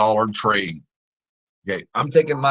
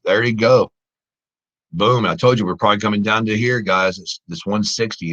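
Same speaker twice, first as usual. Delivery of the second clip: usual speaking voice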